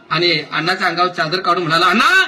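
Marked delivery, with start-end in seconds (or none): 1.9-2.2 put-on high call to rouse the old man; calling to wake. A narrator's reading. Waking the sleeping old man. (झोपलेल्या अण्णांना हाक)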